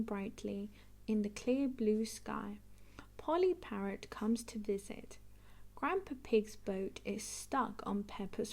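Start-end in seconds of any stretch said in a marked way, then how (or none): none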